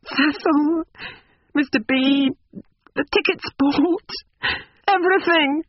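high, broken-up lament; moaning. A narrator's reading. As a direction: moan out each item in a very high character voice. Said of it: "she moaned".

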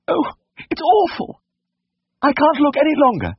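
very high, distraught falsetto; horrified lady. A narrator's reading. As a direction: wail as a lady who cannot bear to look. Lady cannot bear to look.

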